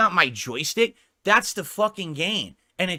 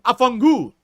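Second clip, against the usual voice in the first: loud, high Italian swear burst; Italian curse; 8 semitones higher, 9 dB louder than usual. Shown as a demonstration. Streamer showing off curse words in Italian, loud outburst. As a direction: belt out the Italian insult with mock gusto. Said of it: "Can I say curse words in Italian?".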